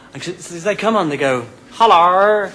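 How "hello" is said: a caller's higher, louder hello, like a send-up; mock-formal hello as if putting on a voice; caller's funny accent. Old-dear phone-in caller greeting the DJ, in a put-on hello. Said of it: "you know, doing funny accents".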